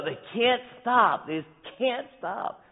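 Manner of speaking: high, slow despairing cry; mock anguish, pitched high and drawn out; exasperated wail. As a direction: wail it like you've given up.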